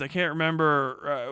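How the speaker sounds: ordinary talk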